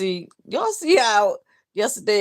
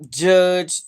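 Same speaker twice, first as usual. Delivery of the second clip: ordinary delivery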